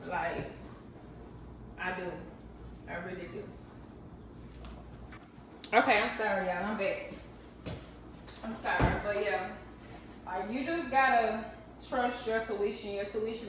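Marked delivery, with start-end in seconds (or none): none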